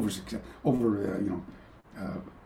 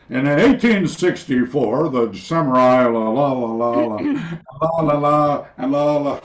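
monotone la-la recital; droning lecture; 5 dB louder than usual. Shown as a demonstration. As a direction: drone on as if reading dull notes. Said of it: "I actually fell asleep giving my".

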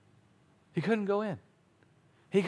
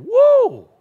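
excited exclaim; 14 dB louder than usual. Real, not acted. Preacher exclaims mid-point.

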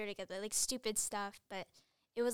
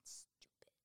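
playful whisper; 20 dB quieter than usual. Shown as a demonstration. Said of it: "the way we say it's like".

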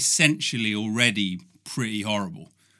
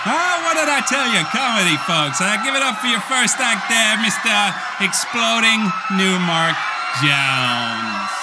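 loud, fast game show shout; shouting host; 8 semitones higher, 4 dB louder than usual. Pretend. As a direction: bring on the next act with a yell.

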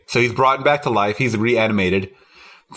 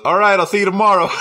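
higher, louder, quick breezy goodbye; casual cop; 6 semitones higher, 3 dB louder, faster than usual. Retold or imitated. Retelling the cop passing the naked zombie, breezy shout. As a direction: toss it off like a clueless cop. Cop's casual line retold.

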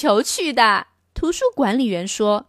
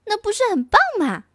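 put-on much higher, fast excited girl voice; higher, quicker happy girl; little girl's delight; 10 semitones higher, faster than usual. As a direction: squeal it like a thrilled child. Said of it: "简宁说".

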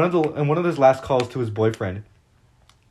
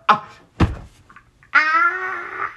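anguished scream; 5 dB louder than usual. Retold or imitated. Reacting to his last words.

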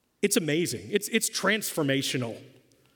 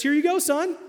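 sweet mom voice; 10 semitones higher, 3 dB louder than usual. Retold or imitated. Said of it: "She says".

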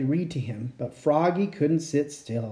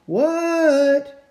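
high, louder drawn-out what; calling back; 10 semitones higher, 5 dB louder than usual. Acted. Froggy answering his mom, calling back loud. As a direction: shout back from the pond.

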